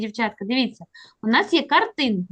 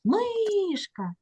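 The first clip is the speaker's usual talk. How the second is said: "Мишка" with МИ high and stretched; high drawn-out МИ, short falling "ка" in "Мишка"; stress on first syllable; 8 semitones higher, 4 dB quieter than usual. Shown as a demonstration. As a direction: call out "Мишка", leaning long on МИ. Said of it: "на який склад у нас падає наголос".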